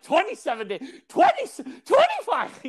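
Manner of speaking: very high, strained comic count; mock-desperate countdown. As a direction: count down as if barely surviving, hammed up.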